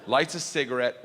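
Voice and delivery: usual speaking voice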